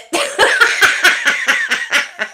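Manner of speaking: long, breathless burst of laughter; helpless laughing. Real, not acted. Streamer collapsing at their own bad impression, helpless laughter. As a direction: crack up completely.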